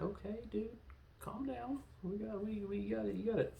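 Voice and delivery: high, hushed pleading with a buddy; soft, high-pitched placating; mock calming down. Mock pleading with oversexed Bond.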